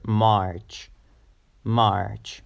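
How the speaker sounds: March with the AR held longer; long, lingering March; lengthened vowel. Shown as a demonstration. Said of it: "pronounce it a bit longer".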